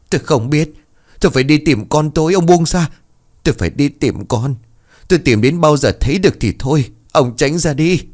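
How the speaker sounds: desperate yell to be let go; mother struggling free of her husband; frantic outburst. A narrator's reading. Wife fights to break free.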